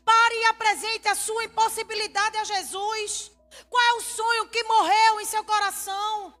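impassioned preaching. Acted. Pastor to congregation about the impossible, impassioned preaching. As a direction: drive it home to the congregation, ringing out.

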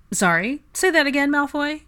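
high, loud mock disbelief; teasing disbelief. A narrator's reading. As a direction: playfully demand it again. Harry teasing Draco to repeat.

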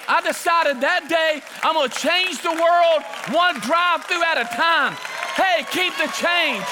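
high, loud, fervent pulpit shout; impassioned preaching with drawn-out punches; full-force preaching. Real, not acted. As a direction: belt it out to rouse the congregation.

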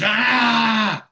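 loud, high imitated scream; high-pitched mock shriek; retold scream. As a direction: act out her sudden scream. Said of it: "she screams".

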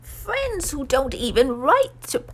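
high, slow, sly elf voice; sly Dobby. A narrator's reading. House-elf needles Harry about his friends, slyly. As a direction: squeeze it out as a sly little elf. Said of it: "said Dobby slyly".

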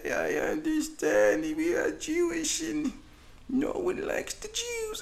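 put-on high, slow, tearful old voice; high slow weeping old person; crying old survivor. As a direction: whimper the plea like a frail old survivor. Old survivor figure pleads.

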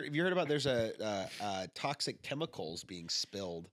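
ordinary talk, nothing marked

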